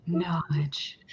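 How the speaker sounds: Knowledge whispered, falling at the end. Shown as a demonstration. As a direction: hush it like a secret: Knowledge. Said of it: "The way you said it".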